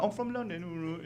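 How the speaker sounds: put-on soft, high garbled London accent; quiet exaggerated British babble; mock London accent. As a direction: mumble it as a cartoon Londoner. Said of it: "And you're like".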